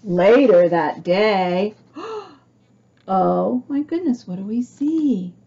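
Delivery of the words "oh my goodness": usual speaking voice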